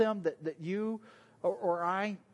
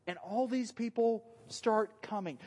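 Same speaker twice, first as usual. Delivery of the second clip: ordinary talk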